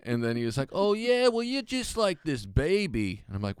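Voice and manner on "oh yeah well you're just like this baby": mocking taunt. Retold taunt from rival comic.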